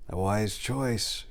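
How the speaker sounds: very slow, soft approval. Acted. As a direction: weigh each word, calmly. Miago approves calmly.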